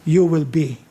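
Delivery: low, quiet, drawn-out final punchline; hushed sermon payoff; climactic soft close. Acted. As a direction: let the last word land softly. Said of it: "climactic punchline, dropping soft".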